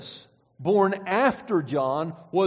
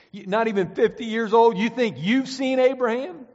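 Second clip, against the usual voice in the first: sarcasm dripping, higher and louder; sarcastic; 4 semitones higher, 4 dB louder than usual. Retold or imitated. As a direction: sneer at the young upstart. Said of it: "You can just hear the sarcasm dripping off of that".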